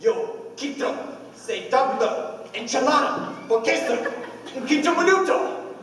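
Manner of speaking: high, excited imitation of Spanish; mock Spanish. Husband imitating the strange man at the door, mock Spanish. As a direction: rattle off garbled Spanish phrases. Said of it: "he says in Spanish".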